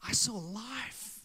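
slow, hushed awe; a hint of quiet wonder; awed John. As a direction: let the words land slowly, amazed. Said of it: "He didn't say".

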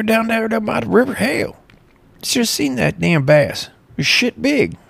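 very high, squeaky hillbilly voice. Pretend. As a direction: spin a yarn like a backwoods good ol' boy. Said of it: "You think rednecks".

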